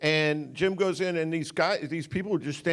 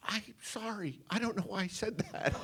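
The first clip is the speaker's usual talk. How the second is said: put-on much softer, embarrassed young voice; sheepish apology; 5 semitones higher, 12 dB quieter than usual. Young makeup girl apologises to directors, soft and embarrassed. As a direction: apologise small and mortified. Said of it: "and she goes".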